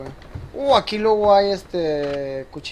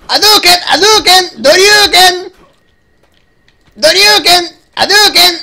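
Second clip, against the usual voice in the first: louder, higher, move-name battle cries; fighting-game shouts; 5 semitones higher, 5 dB louder than usual. Pretend. Gamer shouting move names while brawling, loud. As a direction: call out each special move like a brawler.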